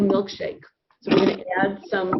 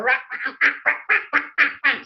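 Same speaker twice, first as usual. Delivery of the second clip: higher squawked "rah" of a cross duck; angry duck squawk; 6 semitones higher than usual. Retold or imitated. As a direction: act out ducks fighting in the pond. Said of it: "and you can hear".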